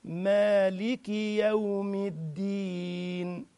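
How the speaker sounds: slow, drawn-out tajweed chant; Quran recitation. Shown as a demonstration. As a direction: recite it slowly, letting each vowel ring. Recites Al-Fatiha.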